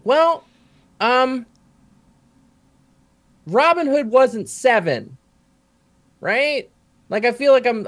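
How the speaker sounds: put-on high, drawn-out kid protest; seven-year-old self. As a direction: imitate a small child pointing out a problem. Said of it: "And I'm like".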